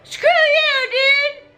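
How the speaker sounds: high, loud defiant shout; high angry yell. Retold or imitated. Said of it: "he's just like".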